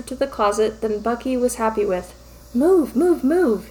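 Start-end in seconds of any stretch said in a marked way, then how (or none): none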